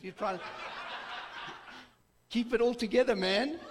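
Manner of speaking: laughing. Real, not acted. Laughing at school caning memory.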